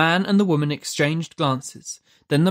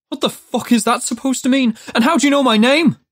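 high, loud, fast shout; alarmed shout; 9 semitones higher, 4 dB louder, faster than usual. A narrator's reading. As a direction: Judd yells at the angel, alarmed and loud.

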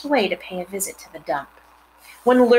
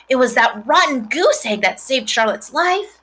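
eager pig; 6 semitones higher, 4 dB louder than usual. A narrator's reading. Pig explains rescue.

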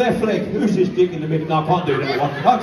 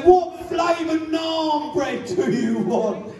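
high slow shouted question; waiter shouting; 7 semitones higher, slower than usual. Retold or imitated. Telling the joke to kids, waiter shouting slowly. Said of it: "And they say".

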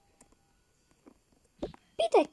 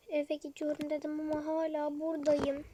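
usual speaking voice

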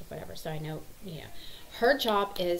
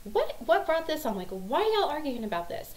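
higher-pitched bewildered demand; exasperated questioning; 8 semitones higher than usual. Retold or imitated. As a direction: ask the kids in mock exasperation. Mom questioning squabbling kids.

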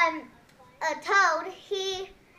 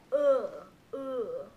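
lower, slow Santa-like voice; low Luigi voice; 6 semitones lower, 5 dB quieter, slower than usual. Shown as a demonstration. Demoing how they voice Luigi, low. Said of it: "It's like a low, low voice".

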